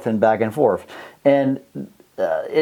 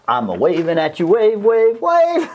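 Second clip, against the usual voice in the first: sing-song, each wave higher; playful waving; 7 semitones higher than usual. Pretend. Teacher waving hello to students, playful rising sing-song.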